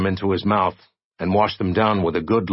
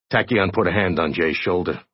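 ordinary delivery, nothing marked

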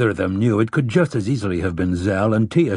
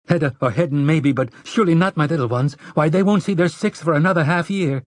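higher-pitched anxious mother; pleading mother; 6 semitones higher than usual. A narrator's reading. Wife to husband about the little ones, anxious pleading. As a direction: plead upward for the youngest children.

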